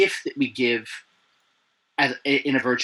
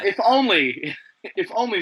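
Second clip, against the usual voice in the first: laughing; 5 semitones higher, 3 dB louder than usual. Real, not acted. Wistful joke about wine.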